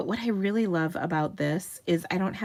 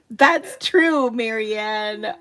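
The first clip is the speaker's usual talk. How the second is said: excited agreement; 4 semitones higher, 8 dB louder, much slower than usual. Real, not acted. Laughing reply to Marianne.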